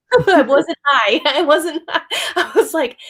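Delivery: giggling through the words; laughing at her own story; real laughter breaking in. Real, not acted. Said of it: "laughs while recounting".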